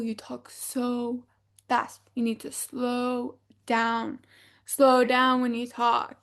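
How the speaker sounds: drawn-out, slow demonstration; mock slow talk. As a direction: stretch the words to show slowness. Massimo mocking their fast talk.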